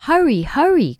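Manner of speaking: high, loud, urgent call; urgent cry. A narrator's reading. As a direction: call out urgently over the storm. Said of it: "cried Jessie".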